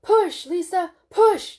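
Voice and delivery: loud high yells to push; yelling. A narrator's reading. Husband yelling at his wife to push. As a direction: shout her on through the birth. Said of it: "Jim yelled".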